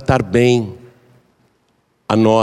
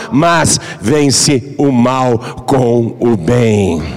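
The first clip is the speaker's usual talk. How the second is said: slow, solemn Bible reading, raised; scripture reading; 4 semitones higher than usual. Acted. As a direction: proclaim the verse deliberately. Reads scripture aloud. (leitura solene da Bíblia)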